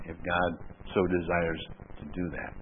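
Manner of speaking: ordinary talk, nothing marked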